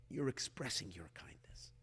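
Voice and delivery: soft unvoiced whisper. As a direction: breathe the words out as a hushed aside. Repeats line, then whispers variant.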